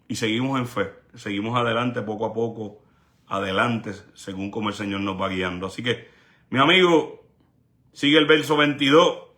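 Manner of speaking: ordinary talk, nothing marked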